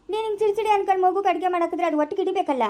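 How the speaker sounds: normal talking voice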